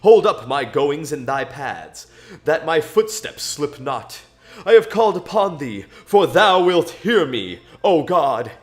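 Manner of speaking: declaimed psalm, pitch lifting then falling on each phrase; solemn scripture reading. Acted. Praying Psalm seventeen to God, declaimed scripture reading. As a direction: read it out like a heartfelt prayer, building in force.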